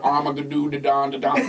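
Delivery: "Armageddon" low, trailing into "da-don, da-don"; glazed-over cue-card fumble of "Armageddon"; botched pronunciation. Retold or imitated. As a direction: drone "Armageddon" into repeated nonsense syllables. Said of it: "He's glazed over the cue card".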